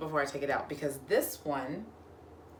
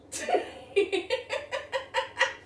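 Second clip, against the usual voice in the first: breathy high laugh. Real, not acted. Laughing at the poncho.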